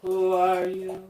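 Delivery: high, slow, wailing ghost voice; eerie ghostly voices. Acted. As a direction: moan it like restless spirits.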